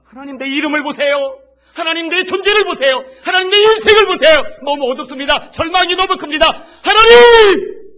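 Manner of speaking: loud, high, desperate pleading shout; a wailing, climbing outcry; crying out in prayer. Acted. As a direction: beg God with everything you have. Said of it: "외치는 거예요".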